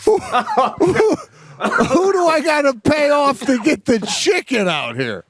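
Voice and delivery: high words breaking into real laughter; high, slow, laughing plea; laughing through it. Real, not acted. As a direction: barely get it out for laughing. Cracking up while retelling.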